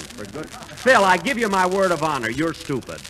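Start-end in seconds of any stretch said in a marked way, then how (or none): none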